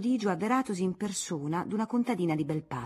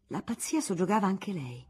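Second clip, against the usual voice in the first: ordinary delivery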